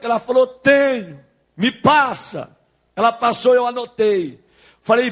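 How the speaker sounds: loud, impassioned pulpit delivery; preaching at full force. Real, not acted. Pastor telling of a broken marriage restored by God, preaching at full force.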